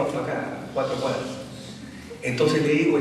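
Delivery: normal talking voice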